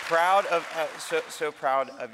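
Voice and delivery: loud, high, excited call to the crowd; rousing call to cheer. Real, not acted. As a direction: pump up the room to cheer. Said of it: "Where's the cheering? Come on".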